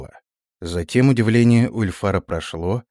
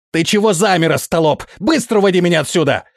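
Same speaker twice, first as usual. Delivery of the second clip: high, loud panicky scolding; squealing yell; 9 semitones higher, 4 dB louder than usual. A narrator's reading. Prince orders the frozen officer to get him out, squealing. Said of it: "Ещё громче визгливо закричал князь".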